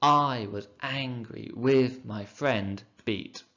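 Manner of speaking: very slow, each stressed syllable marked, beat on the end; teacher scanning Blake's line; scanned reading. Shown as a demonstration. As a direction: read the line to its metre, tapping the missing beat. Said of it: "There's a missing beat at the end".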